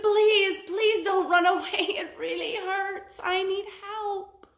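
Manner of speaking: high begging crocodile voice; pleading crocodile. A narrator's reading. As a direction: beg him not to run. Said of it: "And she said".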